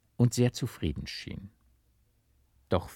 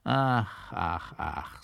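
comic sigh; 3 semitones lower, much slower than usual. A narrator's reading. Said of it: "seufzte er".

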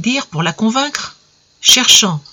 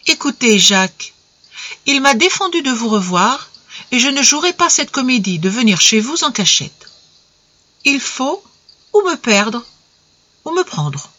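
avec gravité; 5 semitones higher than usual. A narrator's reading. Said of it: "Elle reprit lentement, avec gravité".

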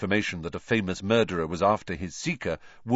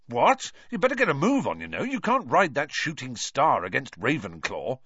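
surprised urging; 6 semitones higher than usual. A narrator's reading. Wood urges Harry, surprised.

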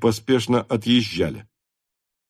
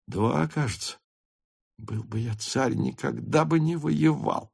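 quiet; 6 dB quieter than usual. A narrator's reading. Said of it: "сказал Несвицкий, отворачиваясь".

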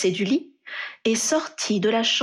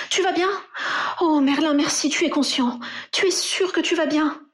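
much higher, anxious and breathless with relief; worried voice; 8 semitones higher than usual. A narrator's reading. Ginny bursting into the hospital room, anxious for Harry. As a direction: rush the questions like a frightened partner. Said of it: "une voix inquiète demanda".

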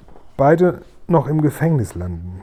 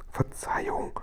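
very soft whisper; whispered apology; 11 dB quieter than usual. A narrator's reading. Sophiechen apologising into Guri's ear, whispering. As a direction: whisper so as not to hurt his ear. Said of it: "flüsterte Sophiechen".

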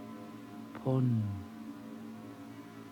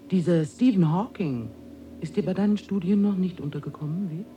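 higher, animated needling; needling question; 7 semitones higher than usual. Acted. Higher being quizzing the visitor on his studies, needling. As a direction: press the visitor with a pointed question.

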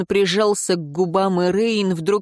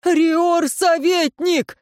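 a much higher, drawn-out despairing cry; desperate shout; 10 semitones higher, much slower than usual. Acted. Vlast desperately shouts for the advisor through the door. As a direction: shout his title in despair. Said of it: "с отчаянием выкрикнул Власт".

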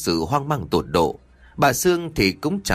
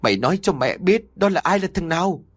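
higher, demanding cry; a mother's higher mock-shouted demand; yelling mother; 7 semitones higher than usual. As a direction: force the name out of her. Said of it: "Bà Sương gào lên".

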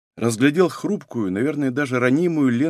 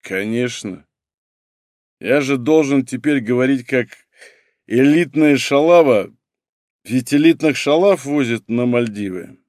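tipsy. Acted. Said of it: "пьяно щурясь".

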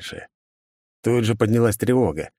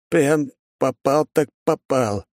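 slow, higher-pitched, drawn-out words; stunned dismay; 6 semitones higher, slower than usual. Acted. Paled partner tells shooter they are in trouble, stunned. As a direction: stretch each word in understated dread.

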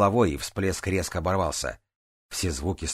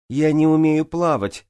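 high, loud cried-out confession; shout; 10 semitones higher, 7 dB louder than usual. A narrator's reading. Джанель admits her problem to the swimmers below, shouting. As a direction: shout it out at full pitch. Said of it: "крикнула Джанель".

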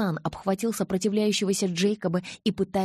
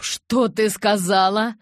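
horrified outburst; 4 semitones higher, 8 dB louder than usual. Acted. Jacob horrified and furious.

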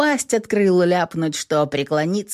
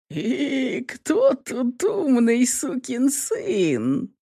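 slow mocking; 7 semitones higher, much slower than usual. Kate mocking him.

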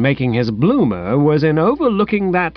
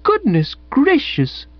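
gushing exclamation; 13 semitones higher than usual. Acted. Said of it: "somebody said".

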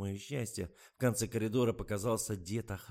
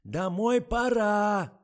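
очень громкий высокий крик; кричит глухой старухе; надрывается; 12 semitones higher, 12 dB louder, much slower than usual. A narrator's reading. (надрывный громкий крик)

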